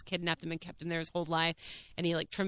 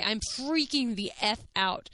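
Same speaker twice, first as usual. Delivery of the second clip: ordinary talk, nothing marked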